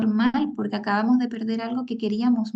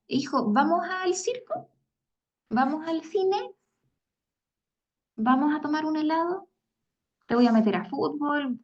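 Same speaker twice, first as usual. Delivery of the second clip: higher, cheerful offers; upbeat distracting mom; 4 semitones higher than usual. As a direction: pitch up and sell the fun to distract. Mamá distrae al niño con actividades. (mamá distrayendo al hijo, animada y más aguda)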